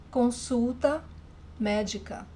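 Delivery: ordinary talk, nothing marked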